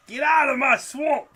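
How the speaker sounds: loud, slow ogre bellow; Shrek voice. Retold or imitated. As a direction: roar it like Shrek. Quoting Shrek at the house.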